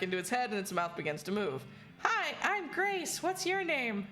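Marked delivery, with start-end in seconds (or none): 2.0-4.1 high, slow, eerie doll voice; sweet and unsettling, like a haunted doll; too calm creepy doll. Acted. Doll voice speaks in game.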